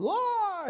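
high startled yelp; a boy's frightened "Ah!"; retold child's yell. Father voicing his falling son, mock yelp. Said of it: "he was yelling".

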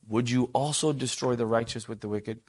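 quiet respectful question; close, hushed ask. Shown as a demonstration. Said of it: "draws near to the Lord to say".

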